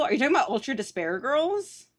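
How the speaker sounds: normal talking voice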